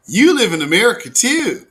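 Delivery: usual speaking voice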